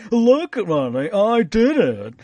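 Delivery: slow, smug bragging voice; proud show-off; smug boast. Retold or imitated. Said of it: "just to say".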